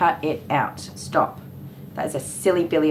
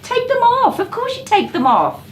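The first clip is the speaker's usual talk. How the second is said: exasperated. Retold or imitated. Parent to literal-minded son about clothing tags, exasperated. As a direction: retort in a much higher, exasperated voice.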